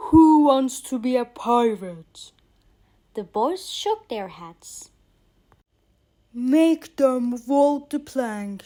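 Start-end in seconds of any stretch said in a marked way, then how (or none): 0.1-2.3 pirate captain voice. Acted. Hook questions captured children. 6.3-8.6 loud, slow villain captain voice; shouting pirate captain. Acted. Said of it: "shouted Hook".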